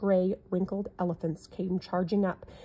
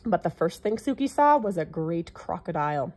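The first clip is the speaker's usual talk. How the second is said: usual speaking voice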